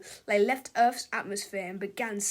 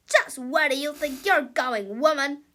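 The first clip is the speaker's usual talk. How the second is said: bossy shout; 6 dB louder than usual. Acted. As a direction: stop the secretary from leaving. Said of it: "shouted Melvin".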